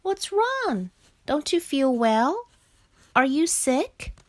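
high-pitched, questioning Frog voice; concerned Frog. A narrator's reading. Frog asks silent Bear if he is sick, concerned. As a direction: fuss over a friend, pitch lifted.

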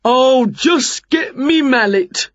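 volunteer heartily as a builder; builder Jerry, eager. A narrator's reading.

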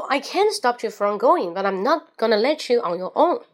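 quick running read, words linked, no pauses; fast, in one breath. Shown as a demonstration. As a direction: rattle it off in one go after a count-in. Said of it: "一气呵成啊". (一气呵成，快速读完整句)

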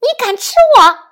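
high, loud challenge; strained yell. A narrator's reading. Fox yelling a challenge at the tiger that caught him, strained yell. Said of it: "扯着嗓子对老虎说".

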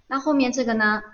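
ordinary talk

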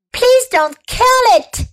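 put-on high, pleading girl's plea; a touch of a sob in a high plea; sobbing. A narrator's reading. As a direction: beg through near tears. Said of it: "she sobbed".

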